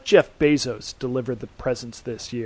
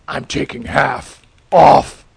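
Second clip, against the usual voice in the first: loud; 11 dB louder, slower than usual. Acted. Superhero delivers his catchphrase.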